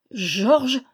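bewildered, questioning echo; a touch of stunned disbelief; stammered surprise. A narrator's reading. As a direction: echo the name in mild bafflement. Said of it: "balbutia le capitaine".

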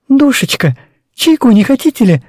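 tender, welcoming mother voice; affectionate hostess. Acted. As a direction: offer tea kindly. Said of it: "ласковый голос Анны Петровны". (ласковый голос хозяйки)

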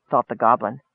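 ordinary delivery

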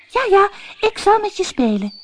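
high, dismissive princess; impatient princess. A narrator's reading. Princess cuts the frog short, high and impatient. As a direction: brush him off impatiently. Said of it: "zei de prinses ongeduldig".

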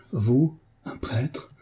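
slow horrified question, then mild reproach; shocked exclamation. A narrator's reading. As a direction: let the shock sink in word by word. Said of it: "s'écria-t-elle".

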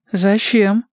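a little jittery, pitched right up; slightly nervous. A narrator's reading. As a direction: ask it as nerves start to creep in. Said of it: "уже немного нервничая".

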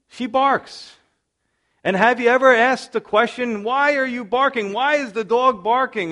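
high and loud, animated; lively storytelling. Real, not acted. Pastor describing his barking dog, animated and high. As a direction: pitch it up, telling a funny dog story.

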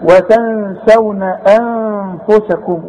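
very slow "وتنسون أنفسكم", each n a held hum; hidden nasalised noon. Shown as a demonstration. Teacher recites for pupil, nasal hidden noon. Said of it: "واخفي".

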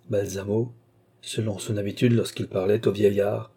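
usual speaking voice

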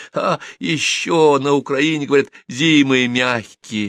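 a freezing man's groan, lightly acted; moaning, teeth chattering. A narrator's reading. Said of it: "лязгая зубами, простонал Саня". (стонет, стуча зубами от холода)

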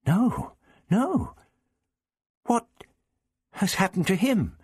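high, worried questioning; anxious, high-pitched dismay. A narrator's reading. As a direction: ask in rising alarm. Joy fading, asks after missing friend.

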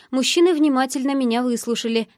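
ordinary delivery

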